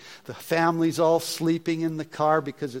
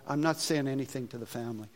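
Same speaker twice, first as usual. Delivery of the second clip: normal talking voice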